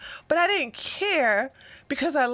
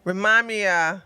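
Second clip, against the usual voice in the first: usual speaking voice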